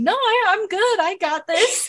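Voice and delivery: high-pitched, hammed-up "I'm fine"; mock brave insistence. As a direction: imitate someone hurt stubbornly waving off help. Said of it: "like seeing somebody dragging a broken leg".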